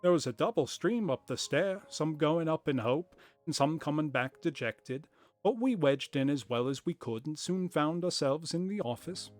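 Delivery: lightly voiced, hammed-up corny accent for Jabez Wilson; corny put-on accent. Wilson telling Holmes about the crowd, in a corny put-on accent. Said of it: "it's kind of fun, though. I think I'll keep doing it".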